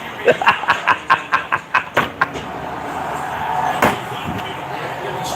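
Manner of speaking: leises, fast tonloses Lachen; quiet wheezing laugh. Real, not acted. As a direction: lach still in dich hinein. Laughing at his own joke.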